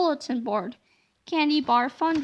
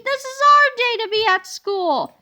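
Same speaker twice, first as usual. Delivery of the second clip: higher, louder firm reproach; stern; 8 semitones higher, 6 dB louder than usual. Acted. Yesterday George tells the boys off, stern and loud. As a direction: insist on it, scolding. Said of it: "said Yesterday George sternly".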